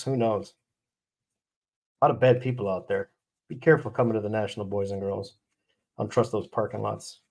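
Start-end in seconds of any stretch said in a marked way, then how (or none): none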